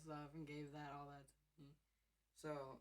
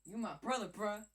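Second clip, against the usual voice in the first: loud teasing; 6 semitones higher, 11 dB louder than usual. Real, not acted. Teasing the cats.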